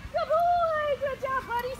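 very high, bright excited praise; praising the puppy for coming; dog praise. Real, not acted. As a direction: gush over the dog like a cheerleader.

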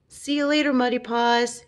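calling goodbye high and bright; cried out. A narrator's reading. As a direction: sing out a hurried goodbye. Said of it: "he cried".

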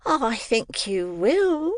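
high, slow whispered coaxing; sly whispered offer; coaxing Mrs Mann. A narrator's reading.

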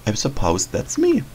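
ordinary talk

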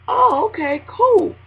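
high, bright-sounding oh okay cool; hammed-up surprised delight; mock chipper surprise. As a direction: squeak it out pleasantly surprised. Surprised pleased reply on phone.

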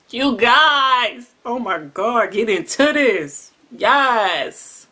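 loud delighted rave; excited. Real, not acted. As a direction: gush over the taste, loud and high. Tasting strawberry, delighted.

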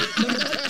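squeaky mocking giggle; high giggle. Mocking giggle after report.

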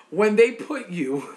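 can barely get the words out for laughing. Real, not acted. Setting up the Kentucky punchline to viewers, laughing. Chitlin duty story.

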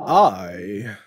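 sudden loud cry; a spirit crying out in protest; spirit's indignant outburst; cried out. A narrator's reading. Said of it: "cried the spirit".

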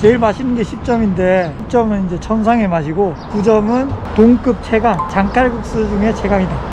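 muffled behind mask; speaking with mouth covered; through a face mask. Real, not acted.

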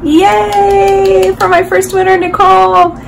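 high, loud cheer for the winner; excited cheer. Real, not acted. Vlogger cheering the giveaway winner to her viewers, excited high cheer. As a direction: celebrate the winner's name with a burst of joy.

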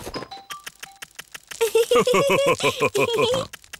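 squealing laugh way up high; high squeal of laughter. Acted. Daddy Pig laughing at the pretend game, squealing high.

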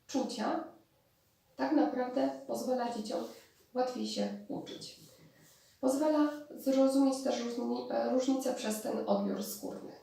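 usual speaking voice

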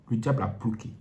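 normal talking voice

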